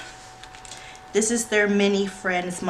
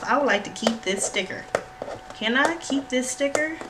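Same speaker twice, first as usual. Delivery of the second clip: ordinary delivery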